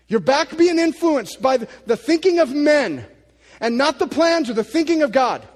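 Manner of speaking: loud, driving preacher voice. Acted. Preacher scolding modern views of Jesus, loud and driving. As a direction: press the point home hard.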